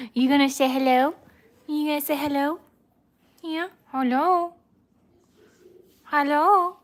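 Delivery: high, cutesy coaxing; pet talk. Real, not acted. Owner coaxing her dog to greet viewers, high-pitched.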